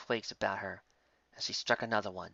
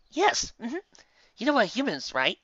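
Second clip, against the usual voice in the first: high, loud, bubbly eagerness; eager Lyra; 13 semitones higher, 7 dB louder than usual. Acted. Lyra eagerly lecturing Twilight on humans, high. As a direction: gush at her.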